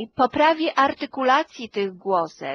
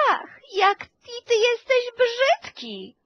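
much higher, cooing sing-song melody; sweet sing-song; 12 semitones higher than usual. Shown as a demonstration. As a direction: coo "ty jesteś brzydki" as if praising a baby. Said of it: "Jeżeli ja powiem".

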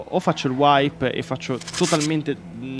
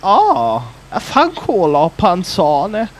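mister Ciao voice; 5 semitones higher, 6 dB louder, much slower than usual. Retold or imitated. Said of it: "farei mister Ciao e direi".

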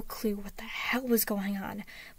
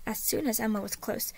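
ordinary delivery

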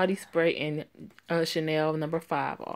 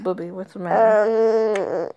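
loud, slow, pleading over a cry; loud fussing call; 3 semitones higher, 10 dB louder, slower than usual. Acted. Mother calling to her crying baby, loud and slow.

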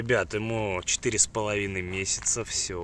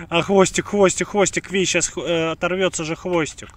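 high, loud excited fussing; 8 semitones higher, 7 dB louder than usual. Real, not acted. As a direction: fuss loudly over the tail. Excited fussing over tail.

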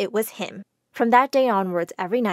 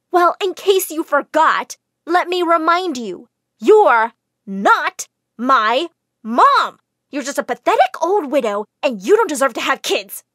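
loud, slow, cutting words; cruel outburst; 4 semitones higher, 7 dB louder than usual. A narrator's reading. Jane to the widow, cruel slow anger. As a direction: hit each word to hurt.

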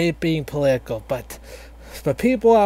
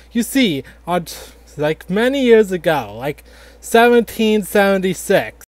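ordinary delivery

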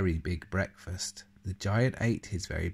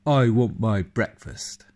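loud, booming giant chant; 2 semitones higher, 9 dB louder than usual. Acted. The giant announcing himself in his castle, loud and booming. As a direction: boom it like a hungry giant. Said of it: "said the giant".

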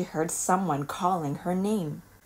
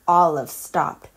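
ordinary delivery

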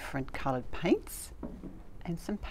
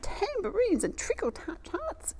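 much higher, slow, drawn-out exclamation; excited exclamation; 3 dB louder, much slower than usual. Acted. Grandma sees hundreds of potatoes, high slow exclamation. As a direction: stretch it out high with wonder. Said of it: "exclaimed Grandma".

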